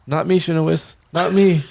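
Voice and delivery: defensive. Acted. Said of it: "He was like".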